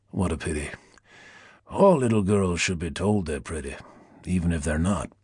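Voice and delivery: low, honeyed Deep South purr; languid Southern drawl. A narrator's reading. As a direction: purr it like a Southern plantation owner. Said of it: "languid southern drawl of a plantation owner".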